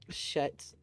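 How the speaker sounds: whispered shushing. Acted. Echoes beak-pinching shut, whispered.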